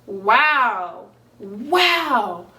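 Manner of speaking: normal talking voice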